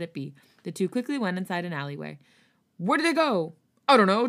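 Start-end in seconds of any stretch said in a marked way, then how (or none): 2.8-3.5 calling out. Acted. Pursuers searching alleyway. 3.9-4.3 calling out. Acted. Pursuers searching alleyway.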